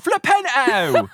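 "Flipendo" cried high, loud and stretched, the D dropped; mock Cockney "Flipendo" as a repeated game sound clip; imitating Harry. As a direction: belt out "Flipendo" slowly, swallowing the D. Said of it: "it sounds almost Cockney-ish".

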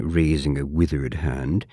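plain reading voice

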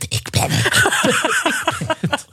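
slow, giggling whisper through a door. Retold or imitated. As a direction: whisper it so nobody else hears, half laughing. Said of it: "ik durfde niet te schreeuwen". (gefluisterd, langzaam en lachend)

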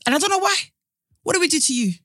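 loud, high, aggrieved outburst; indignant pleading. Acted. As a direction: demand an answer from her, wounded and heated. Heated mock address to absent actress.

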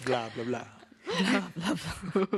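slow, drawn-out vampire babble with a laugh; mock vampire. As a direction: stretch it like a silly vampire. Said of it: "suck your blood".

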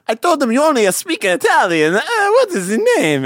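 put-on high, singsong Italian accent. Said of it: "the Italian accent is really".

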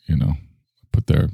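ordinary talk, nothing marked

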